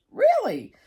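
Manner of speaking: Really? shot up high and loud in disbelief; squeaky incredulous Really?; surprised Really. Retold or imitated. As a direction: mimic the therapist's astonished Really?. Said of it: "And she goes".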